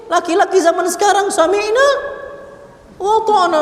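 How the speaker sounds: high-pitched mock compliance; mocking obedience. Preacher mocking husbands who obey wives, high-pitched derision.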